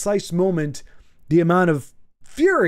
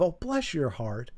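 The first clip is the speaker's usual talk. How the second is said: soft, slow Southern bless your heart; Southern accent; 3 semitones lower, 9 dB quieter, slower than usual. Shown as a demonstration. Doing a Southern accent for a friend, soft and slow. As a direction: drawl it in a Southern accent, gently. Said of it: "Yay, the accent, which was actually really good".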